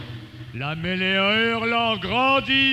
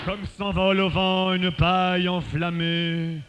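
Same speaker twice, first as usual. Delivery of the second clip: elegiac recital; 9 semitones lower than usual. Acted. Reciter mourning the vanished army, low and elegiac. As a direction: let the voice fall into solemn mourning. Declamation sinks to elegy.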